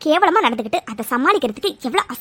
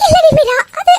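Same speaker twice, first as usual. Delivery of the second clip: loud, high-pitched tearful stammer; mother wailing, lost for words; crying; 11 semitones higher, 5 dB louder than usual. Acted. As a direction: choke out an evasive answer through tears. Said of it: "எதுக்கு இப்ப அழுதுட்டு இருக்கீங்க".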